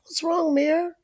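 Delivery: high, worried granny calling out; calling up high to a crying grandson; high concerned call. Retold or imitated.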